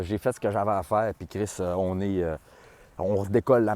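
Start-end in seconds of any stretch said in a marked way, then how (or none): none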